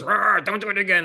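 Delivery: mock growling scold. Wife's mock scolding, retold.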